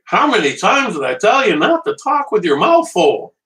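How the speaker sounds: playful imitation of a scolding mom. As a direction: nag him like his mom about manners. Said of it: "she's sitting back going".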